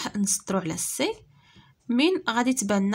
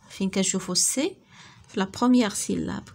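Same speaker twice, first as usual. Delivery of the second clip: normal talking voice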